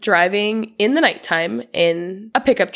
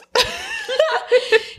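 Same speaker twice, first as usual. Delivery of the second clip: a high, helpless laugh; high burst of laughter. Real, not acted. A podcaster cracking up at her note.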